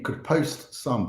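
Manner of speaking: ordinary delivery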